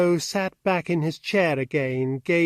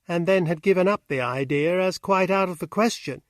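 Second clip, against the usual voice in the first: ordinary delivery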